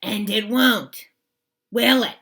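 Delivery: loud menacing warning; menacing Swamp. Acted. Miss Swamp warning the class she's watching, loud and menacing.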